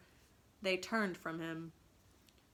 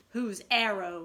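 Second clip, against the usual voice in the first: louder, stern interrogation; stern demand; 8 dB louder than usual. A narrator's reading. Peter demanding who shot Wendy, stern demand. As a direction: demand an answer like a captain. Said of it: "he demanded sternly".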